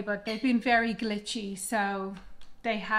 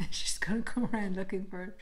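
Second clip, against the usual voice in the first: hushed whisper. Real, not acted. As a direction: whisper it conspiratorially. Whispered aside about dog.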